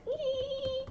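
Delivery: soft, high giddy squeal; small high-pitched "eee" of glee; excited squeal. Real, not acted. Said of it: "I'm so excited about it".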